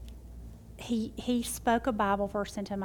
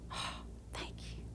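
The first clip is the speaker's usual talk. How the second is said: barely whispered, moved oh thank you; 15 dB quieter, slower than usual. Retold or imitated. Moved reply to daughter's encouragement.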